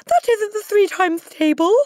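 put-on very high, loud little boy; crying out. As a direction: cry it out as a panicked schoolboy. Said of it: "cried Wilfred".

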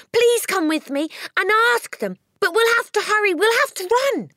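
high, loud urgent shout. A narrator's reading. Matilda shouts to Miss Honey to hurry. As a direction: yell to hurry her along. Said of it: "she shouted".

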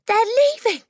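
put-on out-of-breath, high, loud child; panicked cry. A narrator's reading. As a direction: blurt it out in alarm. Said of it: "she cried".

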